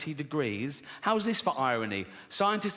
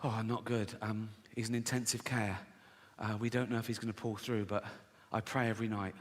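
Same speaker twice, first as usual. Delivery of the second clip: soft, hushed, grave parent voice; lowering the voice for a sick child; quiet worried parent; 5 semitones lower, 7 dB quieter than usual. Retold or imitated. A neighbour tells of a sick child, hushed and grave. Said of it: "And they go".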